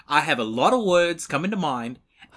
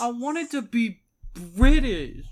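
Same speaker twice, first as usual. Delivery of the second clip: high, slow, plaintive lament; mournful high drawl; sad confession; 8 semitones higher than usual. Acted. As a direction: whimper the thwarted wish slowly. Sad confession after being exposed.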